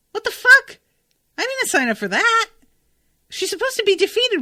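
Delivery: very high, loud outburst, then fast; outraged. Real, not acted. Reader outraged that Clore escapes, shouting in disbelief. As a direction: explode at the book in disbelief.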